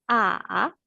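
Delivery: "A" falling low, then rising; drawn-out "A" with a valley in the pitch; dipping third tone. Shown as a demonstration. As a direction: dip down and swing back up on "A". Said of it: "Descendant et montant".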